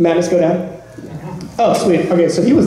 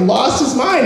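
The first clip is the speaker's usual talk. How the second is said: ordinary talk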